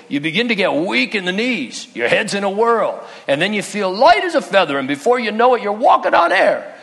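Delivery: storytelling owl rising into giddy excitement. Retold or imitated. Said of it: "Owl says".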